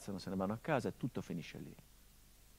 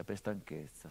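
ordinary talk

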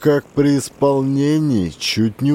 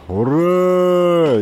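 battle cry; 6 semitones higher than usual. A narrator's reading. Troops charge the trenches.

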